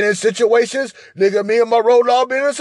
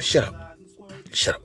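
hissed shut up; 9 semitones lower, 6 dB quieter than usual. Retold or imitated. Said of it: "I was like".

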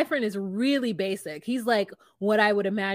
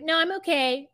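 stubborn refusal; 7 semitones higher than usual. Retold or imitated. Acts out refusing to come out.

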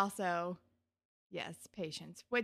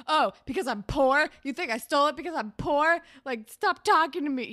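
loud, higher, indignant mock tirade; defensive outburst; 5 semitones higher, 11 dB louder than usual. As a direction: snap back loudly, acting out wounded defensiveness. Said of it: "really defensive and is like".

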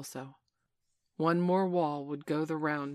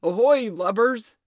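booming, drawn-out pirate captain's hail; big commanding sea captain's holler; Hook imitation call; 6 semitones higher, 14 dB louder, slower than usual. A narrator's reading. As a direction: shout it slowly across the water. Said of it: "he now imitated the voice of Hook".

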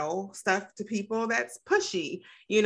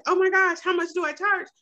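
high, rushed inner panic; a jolt of fear at the question; panicked inner voice; 9 semitones higher, 4 dB louder than usual. Retold or imitated. Speaker recalls being asked her fee, high panicked self-talk. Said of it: "I was really freaking out".

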